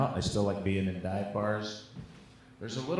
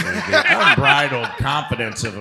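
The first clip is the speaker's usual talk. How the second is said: laughing mock grandeur; 5 semitones higher, 9 dB louder than usual.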